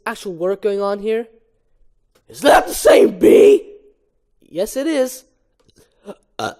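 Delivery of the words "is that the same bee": high, slow, loud hammy shout; Ken shouting. Acted. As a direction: yell it in disbelief.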